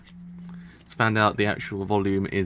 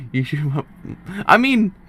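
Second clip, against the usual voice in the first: usual speaking voice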